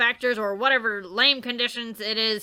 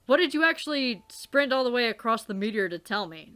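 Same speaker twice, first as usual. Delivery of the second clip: usual speaking voice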